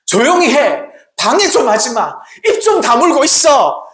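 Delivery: loud, high harsh rebuke; scolding. Acted. Crowd scolding the blind beggar, loud rebuke. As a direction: shut the beggar up. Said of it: "바디메오를 꾸짖었습니다".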